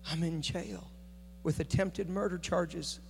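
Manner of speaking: voicing the pastor low and quiet, slowly; hushed, broken admission from jail; quiet, stunned retelling; hushed confession.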